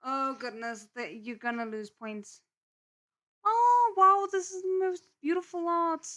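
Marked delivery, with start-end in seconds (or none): 3.4-6.1 high, loud delighted squeal; gushing excited. Real, not acted. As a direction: gush over it like a fan. Gushing over fan art.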